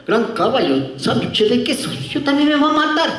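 whiny Pastuso worker, pitch climbing; mock Pastuso accent, high at the end. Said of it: "Y el pastucito abría y decía".

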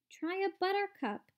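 high, eager cry. A narrator's reading. Wonka offers the visitors another treat, crying out. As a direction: call it out as an eager invitation. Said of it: "cried Mister Wonka".

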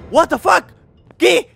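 high, loud, startled disbelief; shocked outcry. Real, not acted. Gamer jolted by a jump scare, shouting in shock. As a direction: blurt it out in real shock.

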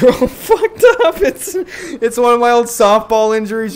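high, loud, laughing through the words; cracking up at the broken legs; giggly disbelief. Real, not acted. Laughing at glitched sim legs.

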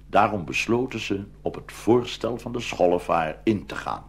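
usual speaking voice

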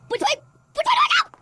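high, loud panicked questions; frantic, lost and scared. Real, not acted. Panicking in the dark.

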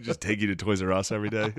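ordinary talk, nothing marked

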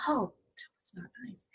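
hushed, breathy-free whisper to herself; whispered aside. Real, not acted. Vlogger whispering to herself about the noisy lid. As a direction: drop to a whisper as a private comment. Aside after squeaky screwing demo.

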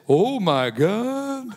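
slow, long-drawn amazed exclamation; mock awestruck gasp. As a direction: stretch every word in exaggerated awe. Staff react to wife's old photo.

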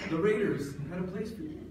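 normal talking voice

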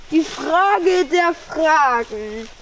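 slow and dramatic. Acted. Child pondering dramatically.

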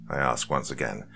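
ordinary delivery, nothing marked

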